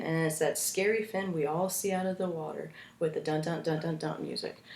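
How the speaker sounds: normal talking voice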